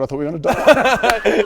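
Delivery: high and loud through laughter; laughing. Real, not acted. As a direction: laugh out the words. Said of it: "I was terrified".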